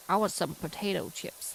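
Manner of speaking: badly faked American accent. British streamer mocking what Americans call crisps, bad American accent. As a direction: put on a clumsy American accent. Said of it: "That was the shittest American accent ever".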